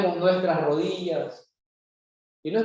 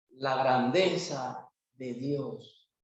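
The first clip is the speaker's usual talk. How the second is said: slow, low and soft; drawn-out, softened emphasis; hushed reverence; 6 semitones lower, 5 dB quieter, slower than usual. Real, not acted. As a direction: let it sink down slowly in awe. Solemn key phrase of sermon.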